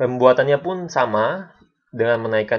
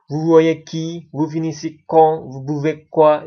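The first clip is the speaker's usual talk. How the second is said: louder, higher questions stressing "qui", "quand", "quoi"; question intonation; 4 semitones higher, 6 dB louder, slower than usual. Shown as a demonstration. Teacher reads three examples that need intonation, raised question intonation. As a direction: lift each question word, "qui", "quand", "quoi", clearly. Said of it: "ada yang menggunakan intonasi".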